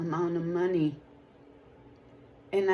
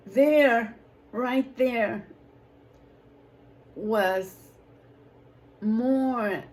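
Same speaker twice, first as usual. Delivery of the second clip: excited outburst; 3 semitones higher, 7 dB louder, much slower than usual. Real, not acted. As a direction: burst out with joy at the answer. Testimony climax, God answered.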